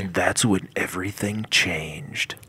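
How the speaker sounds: slow, mock-ominous narration; documentary narrator. Co-host spoofing a documentary's turning point, dramatic narrator. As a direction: announce a big twist, slowly and gravely.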